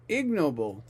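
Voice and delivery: Ignoble with IG punched; stress on first syllable. Shown as a demonstration. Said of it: "The stress is on the first syllable".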